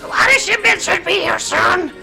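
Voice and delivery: high, slow, strange dragon voice. Acted. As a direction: voice a kobold speaking Draconic. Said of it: "These are dragon voices".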